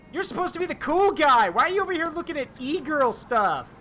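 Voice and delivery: high, loud mock outrage; teasing outrage. Real, not acted. Streamer teasing Arata over e-girl stuff, loud mock outrage. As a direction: scold him in high, loud disbelief.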